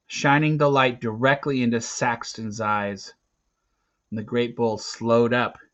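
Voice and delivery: ordinary delivery, nothing marked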